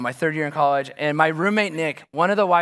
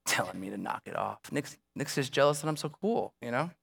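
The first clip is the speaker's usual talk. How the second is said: retold sulky grumbling; 6 semitones lower, 9 dB quieter than usual. Driving alone, quietly grumbling about his friend Nick. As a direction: mutter it like a sulking student. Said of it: "And I'm like".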